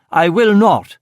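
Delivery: high loud defiant yell; yelled back. Acted. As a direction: shout back in refusal. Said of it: "he yelled back".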